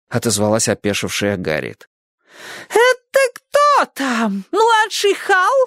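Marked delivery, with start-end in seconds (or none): 2.7-5.5 put-on very high, slow yelling old lady; yelling neighbour. A narrator's reading. Neighbour yells to find who is there, very high, slow. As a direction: yell the question out slowly. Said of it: "вопила миссис Фонтейн".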